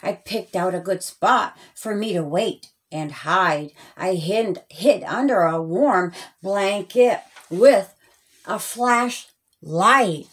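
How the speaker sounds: ordinary delivery, nothing marked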